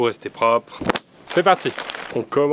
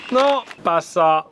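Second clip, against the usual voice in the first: pleading refusal; 5 semitones higher, 4 dB louder, much slower than usual. Real, not acted. Refusing, slowed.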